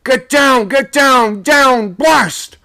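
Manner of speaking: very high, loud yelled orders; a sailor shouting from the main top; frantic shout. A narrator's reading. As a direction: scream the order down. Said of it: "someone shouting to go down".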